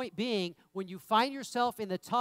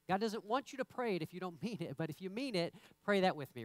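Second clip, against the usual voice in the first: ordinary talk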